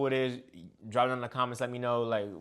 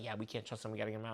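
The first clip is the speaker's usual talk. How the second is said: low, much softer hushed quote; hushed secret; 7 semitones lower, 16 dB quieter, much faster than usual. Retold or imitated. Jason pulling him aside in the bathroom, hushed. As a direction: keep it down so no one hears. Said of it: "pulls me, he's just like".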